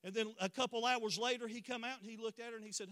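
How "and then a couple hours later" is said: usual speaking voice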